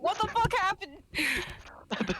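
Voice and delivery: shocked. Retold or imitated. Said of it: "I was just like".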